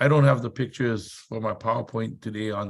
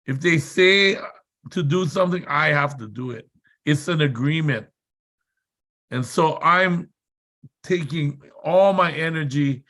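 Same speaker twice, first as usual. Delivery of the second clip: normal talking voice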